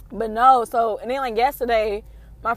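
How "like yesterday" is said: ordinary delivery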